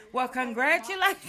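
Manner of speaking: loud, high, laughing; laughing congratulations. Retold or imitated. Father breaking the ice with a loud laughing congratulations. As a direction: burst out with a laugh as you say it. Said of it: "Everybody laughed when he said that".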